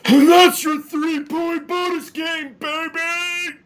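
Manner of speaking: high, loud, drawn-out sportscaster shout; Dick Vitale. Retold or imitated. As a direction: yell it like an over-the-top basketball commentator. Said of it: "You need Dick Vitale to say this".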